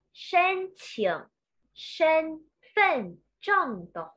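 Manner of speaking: slow, syllable by syllable; reading aloud haltingly. Shown as a demonstration. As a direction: read each character slowly and clearly. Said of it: "read it one character by one character".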